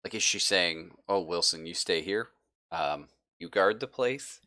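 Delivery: normal talking voice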